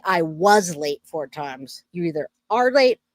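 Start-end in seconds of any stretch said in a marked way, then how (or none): none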